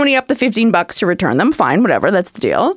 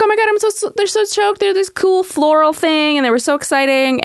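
high, breathless gushing turning sour; gushing excitement; 7 semitones higher than usual. Retold or imitated. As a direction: gush about them, then deflate. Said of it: "So it's like".

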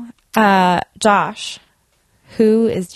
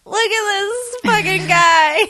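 high excited gush; 11 semitones higher than usual. Retold or imitated. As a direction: gush at it like a baby animal. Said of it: "I cannot help but just go".

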